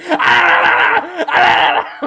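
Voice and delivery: put-on shrill high baby shrieks; baby screeches. Baby attacking him, shrieking high.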